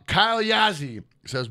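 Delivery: normal talking voice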